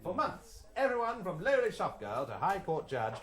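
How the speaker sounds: formal BBC broadcaster read; mock BBC news broadcast; newsreader. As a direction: announce it like the wireless news.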